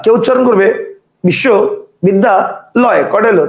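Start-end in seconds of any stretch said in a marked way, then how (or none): 1.2-3.0 বিশ্ববিদ্যালয় slowly in three chunks with pauses; three separate pieces. Shown as a demonstration. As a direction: break বিশ্ববিদ্যালয় into bissho, bidda, loy. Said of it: "তিনটা". (বিশ্ববিদ্যালয় তিন ভাগে থেমে থেমে)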